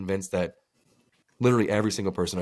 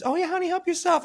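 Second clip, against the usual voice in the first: much higher, sugary nurse voice; sweet nurse. Retold or imitated. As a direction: play the kindly nurse offering the towel. Nurse voiced in retold story.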